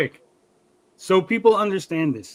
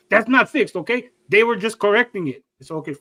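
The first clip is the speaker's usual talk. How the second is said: mock-indignant Mexican accent, higher voice; dad's Mexican accent; 4 semitones higher, 4 dB louder than usual. Son mimics mad dad arguing back, Mexican accent. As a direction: correct them as an irked Mexican-accented dad. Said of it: "I give you an accent".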